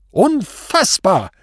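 high, drawn-out roar; loud, stretched cry of disbelief; enraged bellow. A narrator's reading. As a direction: bellow it slowly in outrage. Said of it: "brüllte er deshalb los".

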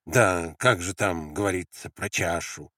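ordinary delivery, nothing marked

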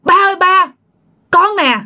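put-on high, crying child spirit calling father; tearful high plea, spirit voice; possessed child crying out. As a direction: cry out to your dad as a lost child. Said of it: "vừa khóc mà vừa nói cái giọng mạnh bạo".

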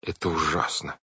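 soft, subdued horror; shuddering dismay. A narrator's reading. Violet taking in the murder, shuddering dismay. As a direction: say it quietly, with a touch of dread. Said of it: "Вайолет содрогнулась".

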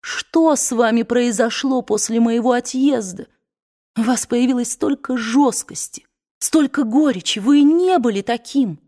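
high, tearful reproach; tragic heroine sorrow. A narrator's reading. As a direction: near tears, hurt and pleading. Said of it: "Глаза её наполнились слезами".

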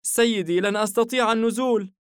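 stuck up high and calling down; girl, worried. Acted. الفتاة عالقة أعلى الرف. (صوت فتاة عالٍ جدا متوسل)

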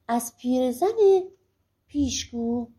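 usual speaking voice

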